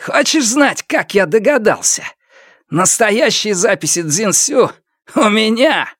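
high-pitched triumphant reveal; gloating. A narrator's reading. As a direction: savour the revelation, pitched well up. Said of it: "он был очень доволен". (торжествующе, высоким довольным голосом)

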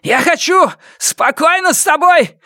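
high shout breaking at the end; voice cracking. A narrator's reading. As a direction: yell in chunks until the voice gives way. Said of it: "На последних словах его голос сорвался". (крик, срывающийся на последних словах)

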